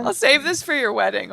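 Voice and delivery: laugh-crying through the words. Real, not acted. Host crying over the guest's fiancé, teary and breaking. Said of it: "I did not expect to say that and cry".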